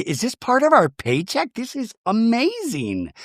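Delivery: high, gushing delight; mock amazement. Castmate never gifted caviar, mock-amazed. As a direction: imitate a castmate marveling in a high voice.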